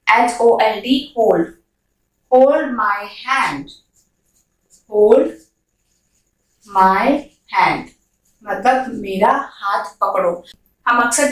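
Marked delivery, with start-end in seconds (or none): 2.3-3.7 slow and drawn out, every word stretched; slow dictation; very slow model sentence. Shown as a demonstration. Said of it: "model sentence slowly". 4.9-7.9 each word separately, the pitch sinking to hand; one word at a time; word by word, falling. Shown as a demonstration. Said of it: "word-by-word repeat".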